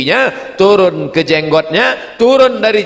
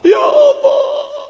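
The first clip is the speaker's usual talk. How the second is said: very high, slow wail; mock weeping cry; 4 dB louder, much slower than usual. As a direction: break down crying to God. Man finally weeps at Kaaba. (tangisan tinggi yang panjang)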